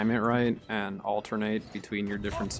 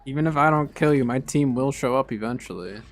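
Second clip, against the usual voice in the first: taunting; 4 semitones higher, 6 dB louder than usual. Real, not acted. Taunting a beaten opponent.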